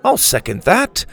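put-on an elderly Englishman agreeing; old Englishman. A narrator's reading. Watson voiced in story.

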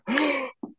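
big high mock gasp; shocked gasp. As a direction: gasp like a scandalized classmate. Imitates classmates' shocked reaction.

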